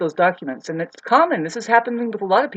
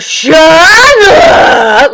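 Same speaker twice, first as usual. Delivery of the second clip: much higher and louder, shouted; retold shout; 10 semitones higher, 17 dB louder than usual. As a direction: yell it like she did. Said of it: "and she goes".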